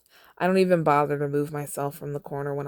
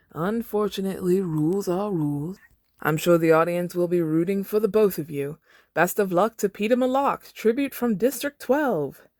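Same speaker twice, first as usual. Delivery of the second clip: showman host voice; 4 semitones higher, 3 dB louder than usual. Acted. Host sending off the tribute, lightly voiced announcer. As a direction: stretch out the big finish.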